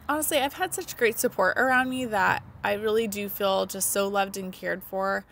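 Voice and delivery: ordinary talk, nothing marked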